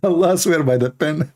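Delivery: ordinary talk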